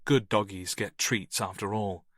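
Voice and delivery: ordinary delivery, nothing marked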